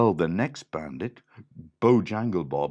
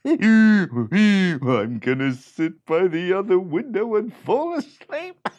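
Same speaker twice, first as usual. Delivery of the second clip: loud, high braying laugh then slow words; donkey bray; 10 semitones higher, 11 dB louder, slower than usual. Acted. The donkey brays and claims the other window. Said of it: "The donkey said".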